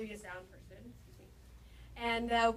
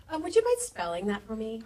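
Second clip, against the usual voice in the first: assistant on phone; 2 semitones higher, 10 dB louder than usual. Retold or imitated. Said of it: "his assistant answers the phone".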